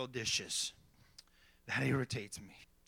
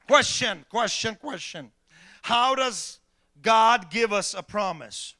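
loud, higher, ringing sermon voice; preaching at full force; 6 semitones higher, 12 dB louder than usual. Acted. Pastor preaching to his congregation, loud and fervent. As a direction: hammer the points home from the pulpit.

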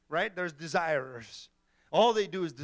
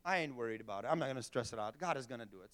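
carefree; 7 semitones lower, 9 dB quieter, much faster than usual. Retold or imitated. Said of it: "head in the sand".

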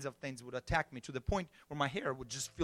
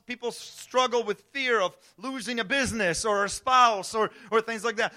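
lifted, louder sermon voice; preaching; 7 semitones higher, 7 dB louder than usual. Acted. Pastor urging the church not to live in fear, preaching louder. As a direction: drive the message home to the church.

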